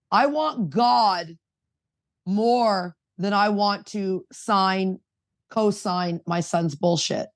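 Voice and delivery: retold firmly, word by slow word; firm, deliberate answer. Mother answering her son's dad about Al-Anon priorities, slow and deliberate. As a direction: drag it out slowly to make the point land.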